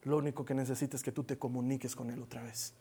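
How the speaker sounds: ordinary delivery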